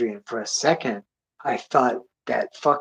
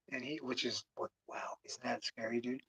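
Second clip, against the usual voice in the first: hushed aside; 3 semitones lower, 17 dB quieter than usual. Real, not acted. Hushed aside about a creepy story.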